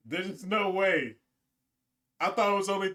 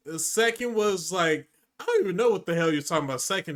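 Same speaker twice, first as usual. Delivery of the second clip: ordinary talk, nothing marked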